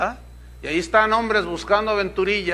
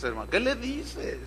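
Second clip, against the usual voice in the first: usual speaking voice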